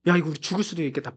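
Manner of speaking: low, quick, hushed realization; dismayed aside. Retold or imitated. Israelites seeing chariots behind them, a hushed dismayed aside. Acted Israelites trapped at the sea.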